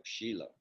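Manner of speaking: Shiloh said as SHEE-loh; correct pronunciation. Shown as a demonstration. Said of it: "That's how you a-, actually pronounce it".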